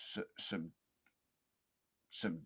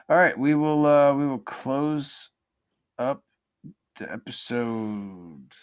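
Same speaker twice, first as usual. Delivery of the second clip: show sign-off; 3 semitones higher, 7 dB louder than usual. Real, not acted. Podcast host closing the episode for listeners, showy announcer sign-off. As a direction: stretch the words and build to a big finish.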